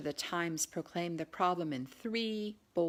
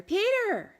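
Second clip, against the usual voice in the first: much higher and louder call across a crowd; shouting a name; 9 dB louder than usual. A narrator's reading. Claire calling her cousin across the crowd, loud shout. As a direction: yell for him over the mob. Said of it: "She hoped her voice would carry".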